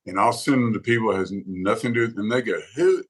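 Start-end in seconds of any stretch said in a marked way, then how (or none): 2.7-3.0 high mock-incredulous "who"; puzzled reaction. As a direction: imitate a listener thrown by a voice. Acts out listeners reacting to singer.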